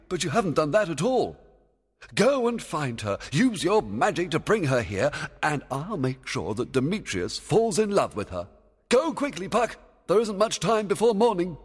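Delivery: loud, high-pitched scolding; scold, then order the servant off urgently; cross fairy king giving urgent orders; angry. Acted. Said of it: "Oberon pointed angrily".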